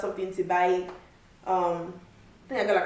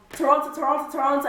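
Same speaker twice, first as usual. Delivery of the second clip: urgent pleading; 6 semitones higher, faster than usual. Retold or imitated. Begging the gate agent.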